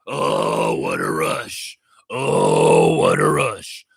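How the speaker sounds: very low gravelly wrestler voice; Hawk impression. Host imitating the wrestler Hawk, deep and gravelly. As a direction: growl it deep like a road warrior. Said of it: "Like Hawk".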